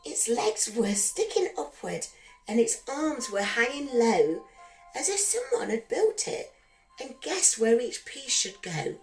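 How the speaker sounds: ordinary delivery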